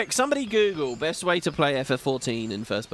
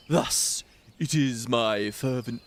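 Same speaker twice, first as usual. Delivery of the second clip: a hint of an old man, slowed and unsure; old man voice attempt; 3 semitones lower, slower than usual. Streamer trying a Macon old man voice for viewers, a faint attempt. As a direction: start a shaky try at an old man, slowly. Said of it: "I'm gonna do a Macon voice".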